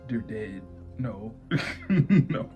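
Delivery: normal talking voice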